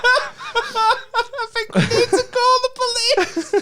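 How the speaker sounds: high, slow words squeezed out between laughs; laughing through it. Real, not acted. Friend jokes about the police, laughing hard. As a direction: try to speak while still cracking up.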